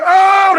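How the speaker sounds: high, drawn-out crowd roar; imitated high cheer. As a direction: holler like amazed onlookers. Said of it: "they, they like".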